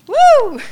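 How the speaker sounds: a loud, high whoop to rouse herself; high whooping cheer. Real, not acted. Nervous speaker whooping to the room before her share, a loud, high cheer. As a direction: throw out a loud "woo" like a cheer.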